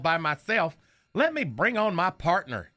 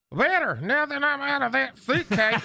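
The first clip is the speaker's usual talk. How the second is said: higher, cheeky dummy voice; Knucklehead's voice; 7 semitones higher than usual. Acted. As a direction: play the wisecracking puppet. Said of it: "still doing Knucklehead's voice".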